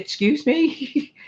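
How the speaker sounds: slow, high, baffled excuse me; half-asleep confusion at a knock; puzzled excuse me. Retold or imitated. Half-asleep wife to stranger at door, slow baffled question.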